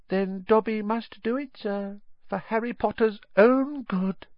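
tragic high-pitched elf voice; small squeaky creature, mournfully resolved; Dobby. A narrator's reading. House-elf about to act for Harry's good, tragic. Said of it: "Dobby gave him a tragic look".

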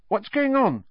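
high, lightly voiced girl; young girl asking. A narrator's reading. A girl arrives and asks what's happening, high. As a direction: ask like a small girl just arriving. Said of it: "said Ginny".

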